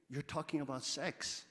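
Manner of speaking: normal talking voice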